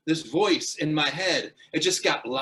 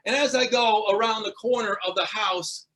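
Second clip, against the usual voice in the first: normal talking voice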